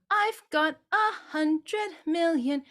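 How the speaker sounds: choppy sung line; wasteful way. Shown as a demonstration. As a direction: sing it the air-wasting way. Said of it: "we actually use up more air".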